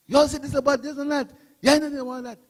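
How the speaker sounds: high, quick mock complaint; mimicked grumbling. Party speaker mimics impatient supporters' complaints, mock grumbling.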